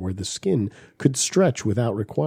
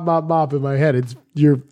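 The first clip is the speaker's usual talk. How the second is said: ordinary delivery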